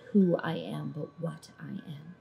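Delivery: ordinary talk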